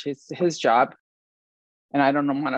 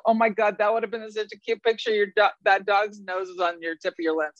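high gushing judge voice; lightly voiced gushing judge; imitating judge; 5 semitones higher than usual. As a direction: gush like a delighted judge. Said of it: "judges go".

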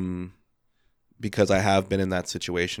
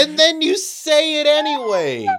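much higher squeaky outraged laugh, slowed; shocked disbelief; 4 dB louder, slower than usual. Real, not acted. Repeats outrage.

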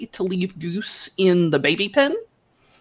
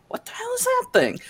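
astonished; 4 dB quieter, faster than usual. Retold or imitated. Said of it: "and they're like".

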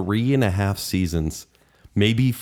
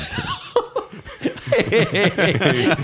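laughing; 13 semitones higher than usual. Real, not acted. Joke lands, big laugh.

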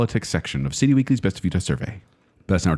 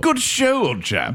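mock British; 9 semitones higher, slower than usual. British accent bit.

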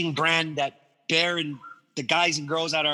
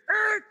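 high imitated skid screech; tire screech; 3 dB louder than usual. FedEx van skids to a stop, screeching. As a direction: squeal like braking tires. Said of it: "it skids, and you hear".

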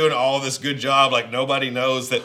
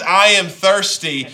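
I am thirsty, louder and slow, stress on I; emphatic slow quote; 6 semitones higher, 8 dB louder, slower than usual. Shown as a demonstration. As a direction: lean hard on I, then drag out thirsty. Quoting Jesus again, emphatic.

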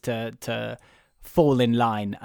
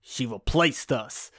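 put-on Lotso accent; slower than usual. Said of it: "my accent went, it just disappeared there".

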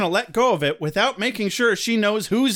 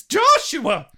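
scolding mom; 8 semitones higher, slower than usual. Pretend. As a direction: call him out like an exasperated mother. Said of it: "someone's missing their entrance".

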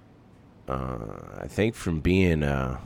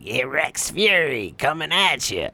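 high, forced cartoon tough-guy voice; put-on action-figure battle cry; Rex Fury; 11 semitones higher than usual. Shown as a demonstration. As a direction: announce yourself like a toy-story brawler. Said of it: "Rex Fury character. So it was like".

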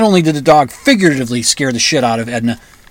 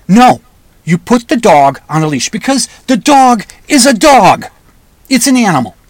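higher, emphatic insistence; exasperated rebuttal; 6 semitones higher, 4 dB louder than usual. Pretend. Walker rebutting a careless dog owner, exasperated and insistent. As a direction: lay down the rule word by word.

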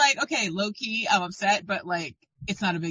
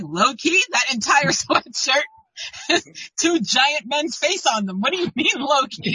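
raised, louder mock disbelief, slowed in the middle; incredulous challenge; 6 semitones higher, 4 dB louder, slower than usual. Sister-in-law challenges her about the giant sweatshirt, incredulous. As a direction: imitate someone incredulous, half laughing.

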